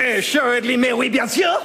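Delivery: emphatic stage French; outrageous French accent. Acted. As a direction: confirm it in a flamboyant French accent. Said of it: "outrageous French accent".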